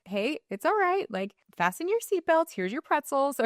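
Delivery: cheery flight attendant. Retold or imitated. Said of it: "But if they're like".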